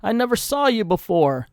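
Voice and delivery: high, loud acted protest; protesting mother. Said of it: "But the mother protests".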